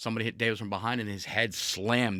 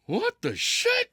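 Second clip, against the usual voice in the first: much higher, slow, drawn-out shocked cry; shocked squeal; 4 dB louder, much slower than usual. Retold or imitated. Host voices Bobby seeing Lio Rush beside him, high shocked cry. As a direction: shriek it in mock horror. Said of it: "And Bobby's like".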